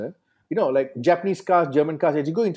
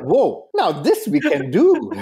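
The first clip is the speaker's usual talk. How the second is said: excited; 5 semitones higher, 4 dB louder, much slower than usual. Retold or imitated. Retold excited reaction.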